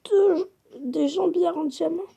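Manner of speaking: high, slow, near-tearful joy; almost crying with joy; overjoyed. Real, not acted. Finding diamond leggings.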